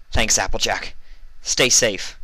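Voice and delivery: calling out. Acted. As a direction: call back over the noise. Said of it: "Vinyl called out".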